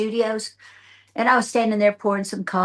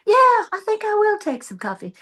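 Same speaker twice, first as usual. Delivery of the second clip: mimicking executive; 13 semitones higher, 3 dB louder than usual.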